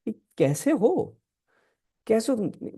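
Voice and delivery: high-pitched voice playing Parvati; Parvati's questioning voice. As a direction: ask as the goddess, pitched well up. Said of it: "Parvati मैया उनको ऐसे देख रही है". (पार्वती बनकर ऊँचे सुर में पूछना)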